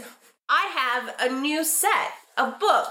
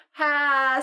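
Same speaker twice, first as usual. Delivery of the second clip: usual speaking voice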